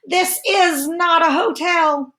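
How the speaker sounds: loud, high furious yell; angry bear shout. Acted. Bear yelling at guests to leave, furious. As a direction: roar it at the unwanted guests. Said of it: "lets us know how to read it".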